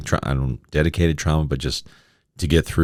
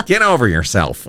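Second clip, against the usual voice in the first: imitating mom; 7 semitones higher, 4 dB louder than usual. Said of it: "that's her line".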